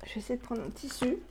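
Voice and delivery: quick whispered aside to herself; whispering. Real, not acted. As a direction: drop to a fast whisper. Aside while struggling with lid.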